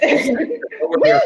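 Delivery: giddy shout over the giggles; excited call. Real, not acted. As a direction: yell it out with a laugh. Hyped calling out.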